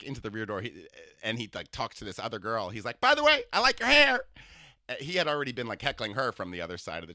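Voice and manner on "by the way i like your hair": high, loud, sing-song camp voice; imitating a flamboyant older man. To a stranger on the subway, gushing camp impression. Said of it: "I imitate people".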